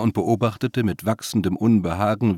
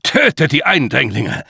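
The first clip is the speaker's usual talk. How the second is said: loud, higher-pitched command; fearful anger; 6 semitones higher, 6 dB louder than usual. A narrator's reading. Old executor orders intruders killed, angrily. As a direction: order it in fear and fury. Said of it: "Furcht und Zorn".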